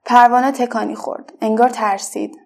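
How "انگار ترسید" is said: usual speaking voice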